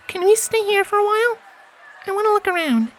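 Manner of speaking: very high little filly voice; a small child hoping for a yes; pleading filly. A narrator's reading. Primrose begging Scarlet to stay, pleading.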